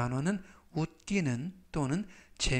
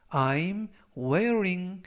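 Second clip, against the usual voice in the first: each word stretched out, higher; very slow teaching read; 4 semitones higher, much slower than usual. Shown as a demonstration. Teacher reading example to students, very slow. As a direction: read it very slowly for learners. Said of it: "활용 문장 보시겠습니다".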